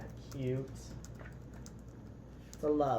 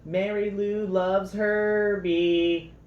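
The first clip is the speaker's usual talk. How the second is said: louder, slow, sing-song teasing; 6 semitones higher, 10 dB louder, much slower than usual. Acted. Jethro teasing Herbie about his admirer, slow sing-song taunt. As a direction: drag it out to tease a friend. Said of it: "teased Jethro".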